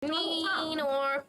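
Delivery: siren imitation. Echoing the siren.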